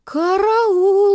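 very high, loud scream for help; panicked shout. A narrator's reading. Elizaveta Nikolaevna seeing the bees, loud panicked shout. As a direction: yell "Караул!" in panic. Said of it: "закричала Елизавета Николаевна".

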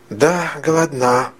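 put-on a simple, pitiful echo from a child; plaintive and artless. A narrator's reading. Hungry little girl repeating her brother's words, plaintively. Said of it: "жалобным простодушием повторила девочка".

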